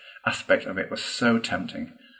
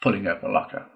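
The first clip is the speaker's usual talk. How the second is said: ordinary delivery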